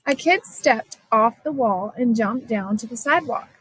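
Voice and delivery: usual speaking voice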